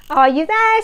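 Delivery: usual speaking voice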